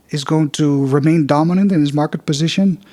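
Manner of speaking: normal talking voice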